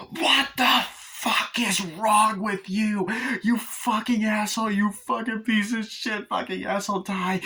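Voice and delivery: high, slow, drawn-out acted fury; screaming rage. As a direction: rant hysterically after a fleeing driver. Acts out woman raging at driver.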